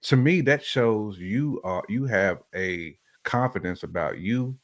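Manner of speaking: normal talking voice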